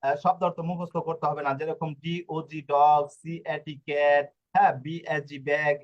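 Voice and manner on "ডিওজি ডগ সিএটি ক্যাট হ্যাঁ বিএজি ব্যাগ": slow letter-by-letter spelling drill. Shown as a demonstration. Teacher mimics rote spelling to learners, spelled out slowly. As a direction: spell each word slowly, then say it whole. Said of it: "বানান করে করে".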